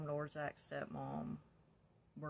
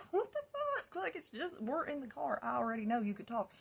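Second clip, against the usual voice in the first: high, loud incredulous shout; mock his disbelief, going high; imitating a stunned boyfriend; baffled outburst; 9 semitones higher, 7 dB louder than usual.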